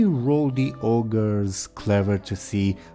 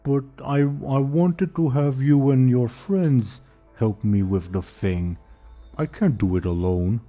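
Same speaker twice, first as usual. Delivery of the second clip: dopey ogre voice; 5 semitones higher than usual. Acted. GM voicing the ogre.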